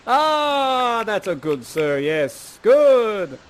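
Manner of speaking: high, loud drawn-out Ah and good; approving father. Acted. Father pleased the suitor accepts, hammed-up loud approval. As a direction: crow approval, swooping high at both ends.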